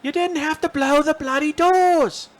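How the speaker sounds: hammed-up famous Caine line; Michael Caine impression. Buddy quoting Caine's movie line, high, loud and slow. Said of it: "Michael Caine impressions all night".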